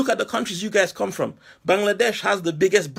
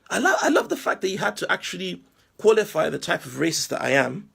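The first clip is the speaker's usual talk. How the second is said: usual speaking voice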